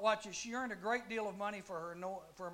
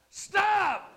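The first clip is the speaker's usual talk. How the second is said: commanding shout; 11 semitones higher, 15 dB louder than usual. Retold or imitated. Paul rebuking the demon.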